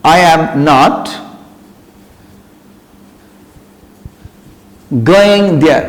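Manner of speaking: I am not drawn out very slowly; slow model sentence. Shown as a demonstration. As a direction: dictate it slowly to the class. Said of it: "তুমি যদি দাও". (ধীরে ধীরে উদাহরণ বাক্য)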